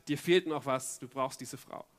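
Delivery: low voice sinking to a hush; quiet tempter. Acted. Inner voice tempting David about Bathseba, low and hushed. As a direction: suggest it softly, like a thought in the head.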